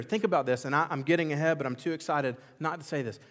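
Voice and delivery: normal talking voice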